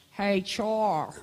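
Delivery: a hint of Pennsylvania accent, lightly imitated; cousin's Pennsylvania greeting, lightly imitated. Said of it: "That's how he talked".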